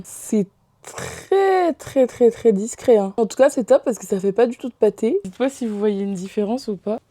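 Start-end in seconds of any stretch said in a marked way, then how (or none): 0.0-3.1 mock-praise a shade that is anything but subtle; playful irony; sarcastic. Real, not acted. Ironic remark on a too-dark colour.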